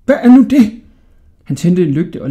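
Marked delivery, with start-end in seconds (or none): 0.1-0.8 high, loud startled question. A narrator's reading. Old jailer startled by a voice, high and loud. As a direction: demand to know what that was.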